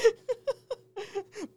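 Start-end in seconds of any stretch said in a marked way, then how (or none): 0.0-0.1 helpless high-pitched giggling; real laughing. Real, not acted. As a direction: blurt it out while cracking up. Cracking up at co-host's joke.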